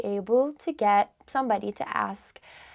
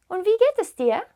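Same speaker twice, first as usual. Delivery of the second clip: "Und wie geht es dir" pitched higher as a question; rising question intonation; 7 semitones higher, faster than usual. Shown as a demonstration. Teacher demonstrates a German question, voice going up. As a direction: lift the voice through "geht es dir". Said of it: "I was going up".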